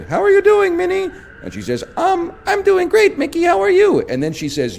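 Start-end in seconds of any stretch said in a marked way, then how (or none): 0.0-1.1 much higher, squeaky cartoon mouse; Mickey Mouse voice. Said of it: "in a voice that hurts my throat". 2.0-4.0 Minnie voice. Retold or imitated. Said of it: "three different voices that hurt my throat".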